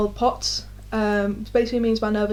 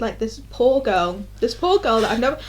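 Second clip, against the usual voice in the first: ordinary talk, nothing marked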